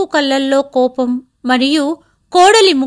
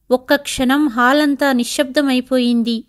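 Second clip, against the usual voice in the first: plain reading voice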